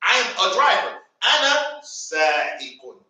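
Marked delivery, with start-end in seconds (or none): 1.2-3.0 Ana sa'iqun low and drawn out; slow model phrase. Shown as a demonstration. Teacher models the phrase before the class repeats. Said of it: "Say it with me".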